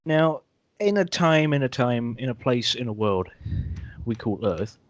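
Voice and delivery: slow dramatic trailer voice; old sci-fi announcer. Pretend. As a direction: intone it like an old movie trailer. Dramatic announcer-style intro.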